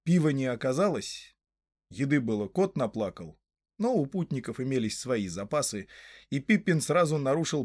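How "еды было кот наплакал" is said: usual speaking voice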